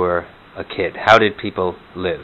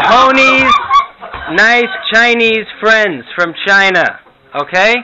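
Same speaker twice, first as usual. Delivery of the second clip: louder, higher, slow grandma repeating herself to a deaf husband; Herta repeating louder; 11 semitones higher, 5 dB louder, slower than usual. Pretend. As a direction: spell it out loudly for deaf Morty. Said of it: "some Morty and Herta imitations".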